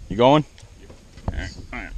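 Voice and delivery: ordinary delivery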